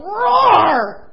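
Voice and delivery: huge roaring shout, high and loud; mighty lion roar. Acted. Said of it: "used to hearing him go".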